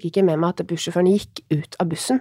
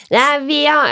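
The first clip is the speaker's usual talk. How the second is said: imitating a Molde man; 6 semitones higher than usual. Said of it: "jeg kan ikke etterligne det der da".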